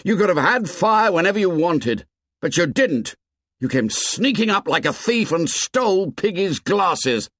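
high-pitched angry accusation; indignant, high outburst; blazing temper. A narrator's reading. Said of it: "Ralph's temper blazed out".

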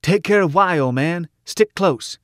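high, urgent shout; shouted over shoulder. A narrator's reading. Shorty to his companion in the fight, urgent high shout. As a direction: call out urgently to someone behind you. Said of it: "shouted over shoulder".

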